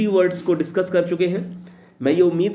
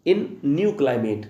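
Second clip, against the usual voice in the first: slow dictation; much slower than usual. Shown as a demonstration. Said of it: "teacher repeats the definition".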